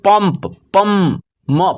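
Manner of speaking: पंप said with the anusvara as a held M. Shown as a demonstration. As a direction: sound out पंप as पम, closing on म. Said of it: "इसका उच्चारण जो कि ... और एक म के रूप में".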